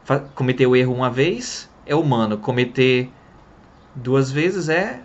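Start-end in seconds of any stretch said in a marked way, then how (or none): none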